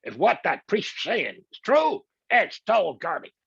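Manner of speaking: put-on slow, high Brooklyn accent, brash. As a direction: sound like a Brooklyn guy telling off the king. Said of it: "He's got a Brooklyn accent".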